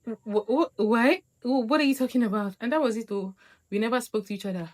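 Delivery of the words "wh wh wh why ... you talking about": flustered. Retold or imitated. Stammering reply to accusation.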